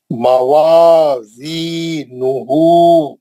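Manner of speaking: slow, drawn-out Quranic recitation. Shown as a demonstration. Teacher recites word slowly for class. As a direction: chant each syllable slowly for students. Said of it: "नून पे पेश है एक हरकत".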